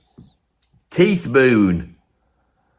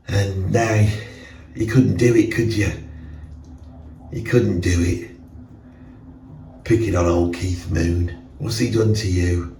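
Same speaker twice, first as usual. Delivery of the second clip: scolding reflection, lower voice; mirror self; 5 semitones lower than usual. Acted. His mirror self scolds him for putting drummers down, low reproachful voice. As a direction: nag yourself like a reproachful double. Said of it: "talking to yourself in the mirror".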